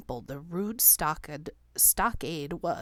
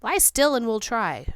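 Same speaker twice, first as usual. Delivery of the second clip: offered; 5 semitones higher, 7 dB louder than usual. A narrator's reading. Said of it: "Charbonneau offered".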